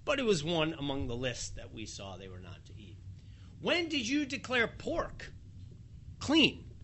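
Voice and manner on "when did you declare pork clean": higher, clean jumping way up in disbelief; mock shocked Peter asking about ostrich; incredulous question. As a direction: ask it amazed, with a laugh before the last word. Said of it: "what's shocking here is".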